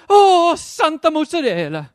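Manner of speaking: high, loud mock-Italian wail; bummed-out Italian girl. Little girl bummed out, swearing by cheeses, mock-Italian accent. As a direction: lament it loudly in a hammed-up Italian accent. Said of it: "the next time she gets bummed out".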